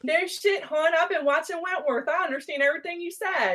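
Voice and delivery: high, thick Baltimore woman; Baltimore accent. Pretend. Replying to the Australian accent, thick high Baltimore accent. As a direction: play the Baltimore "hon" lady.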